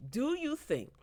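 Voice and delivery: ordinary talk, nothing marked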